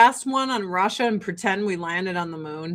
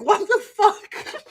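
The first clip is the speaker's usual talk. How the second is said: high, drawn-out baffled reaction; mock bewilderment; 9 semitones higher, slower than usual. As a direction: react stunned, half laughing. Acting out a stunned viewer.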